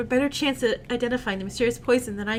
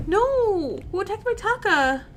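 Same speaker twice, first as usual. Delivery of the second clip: shocked; 7 semitones higher, slower than usual. Acted. Character shocked by news.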